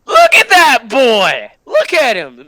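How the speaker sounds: loud, thrilled, slowed-down yell; excited shout. Real, not acted. Streamer yelling over a cute pony on screen, excited shout. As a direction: gush at the screen at full volume.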